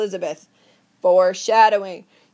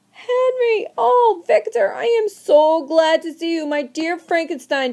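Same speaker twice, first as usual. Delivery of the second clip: overjoyed greeting; 6 semitones higher than usual. Acted. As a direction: greet your old friend with a burst of joy. Henry greets Victor gladly.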